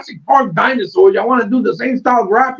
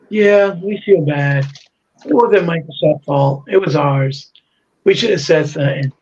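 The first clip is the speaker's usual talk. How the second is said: mock contrite apology; 7 semitones lower than usual. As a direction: drag it out like a fake mea culpa. Mocking the studio's late apology.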